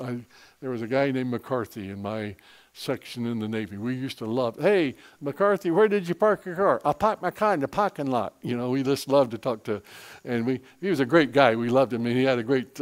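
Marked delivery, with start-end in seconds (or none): 4.6-6.8 loud, higher call to a buddy. Retold or imitated. Teasing shipmates calling to a navy buddy. 6.8-8.3 imitated Boston accent, R's dropped, pitched up. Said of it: "Boston accent. He could not pronounce R's".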